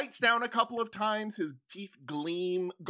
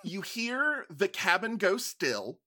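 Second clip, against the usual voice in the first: ordinary talk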